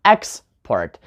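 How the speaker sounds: export as EX, then a lighter port; stress on first syllable. Shown as a demonstration. Teacher repeating the noun, export stressed on EX. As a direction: punch the EX in export. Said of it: "On the first syllable".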